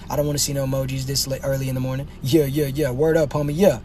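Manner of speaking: ordinary delivery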